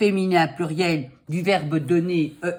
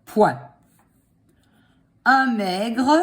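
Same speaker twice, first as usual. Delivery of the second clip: dictating; 3 semitones higher, much slower than usual. Shown as a demonstration. Dictating slowly.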